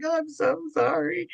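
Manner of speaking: put-on high, teary child's apology; imitating a little girl crying sorry; mock-sobbing high child voice; retold sobbing apology. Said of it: "and I was sobbing".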